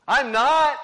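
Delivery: sharp shouted denial, high and loud; Peter's loud denial. Acted. Peter shouting his denial to the accusers, panicked loud. Peter denies, under pressure.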